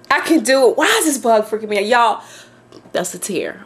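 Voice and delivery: ordinary talk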